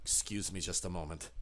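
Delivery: low, soft, politely cold host voice; a calm butler excusing himself; low quiet courteous menace. Acted. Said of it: "And he's like".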